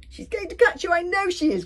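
high, loud squeal of dread; panicky cry. A narrator's reading. Fishlegs sure the witch will catch Hiccup, panicky cry. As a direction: cry out the warning, high-pitched. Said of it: "cried Fishlegs".

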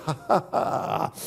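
unvoiced sneering laugh; a quiet, breathy taunting laugh; mocking breathy laugh. Preacher voicing hell, a quiet mocking laugh.

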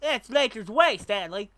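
high, loud, drawn-up cartoon character voice; Jimbo. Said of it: "But Jimbo doesn't have a Southern accent".

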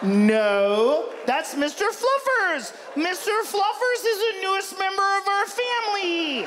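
high, drawn-out owner scolding the dog; protective pet owner. Acted. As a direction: insist on it in a high voice, stretching the words.

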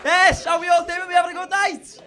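loud, high stage greeting; rousing comic's opener; calling to the crowd. Real, not acted. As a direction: shout hello to the whole room.

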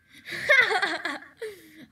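big burst of laughter; loud laugh. Real, not acted. Amused by own story.

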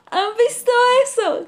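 high, loud mocking crow cry; crow shouting. A narrator's reading. Crow shouts to the birds, high and loud. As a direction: shout it out to everyone. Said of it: "grita el cuervo".

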